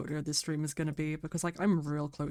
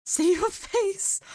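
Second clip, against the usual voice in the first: much higher, slow drawn-out squeal; excited squeal; 6 dB louder, much slower than usual. Real, not acted. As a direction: stretch it out in giddy shock. Squealing at a large donation.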